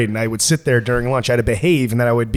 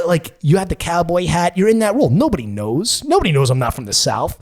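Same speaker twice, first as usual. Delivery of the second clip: ordinary talk, nothing marked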